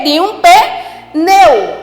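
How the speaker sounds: "pneu" esticado e pausado; bem devagar. Shown as a demonstration. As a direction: resposta arrastada de professora. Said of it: "Geni sentada em cima de quê?". (professora responde devagar)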